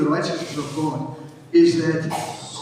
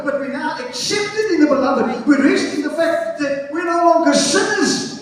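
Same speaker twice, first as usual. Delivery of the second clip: high, urgent preacher voice; full-force preaching; 10 semitones higher, 4 dB louder than usual. Real, not acted. Preacher telling congregation they rest in salvation, full-force preaching. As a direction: drive each phrase out to the congregation.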